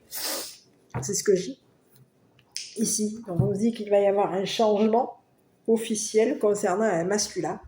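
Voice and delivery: ordinary delivery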